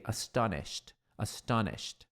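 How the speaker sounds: astonished said "astónisht", ending in t, softly; astonished with a quiet crisp t at the end; pronunciation demo. Shown as a demonstration. Teacher quietly modelling the ending for students, astonished ending in t. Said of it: "Aqui também termina com T".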